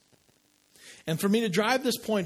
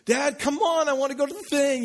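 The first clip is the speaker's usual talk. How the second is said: put-on high, loud nagging child; pleading kid; 10 semitones higher, 4 dB louder than usual. Kid pestering his busy dad to go, pleading. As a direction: whine at dad like an impatient kid. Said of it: "I'm going at him as a kid".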